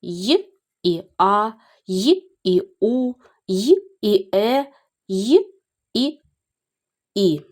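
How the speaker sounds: teacher slowly separating "Й" from the vowel that follows; two separate sounds. Shown as a demonstration. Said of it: "вони будуть завжди позначати два звуки".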